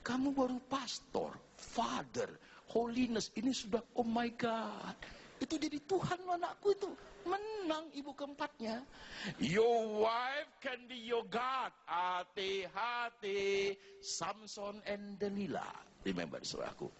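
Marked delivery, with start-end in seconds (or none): 0.1-8.9 higher, gleeful bragging; excited high boasting. Retold or imitated. Fourth mother gloating over the other mothers, excitedly higher. 9.4-13.7 slow, loud, drawn-out warning, sung-out Hati-hati; slow solemn warning. Real, not acted. Preacher warning his congregation, slow and drawn-out. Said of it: "preacher warns congregation".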